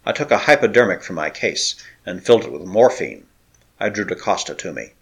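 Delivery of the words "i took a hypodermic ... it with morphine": plain reading voice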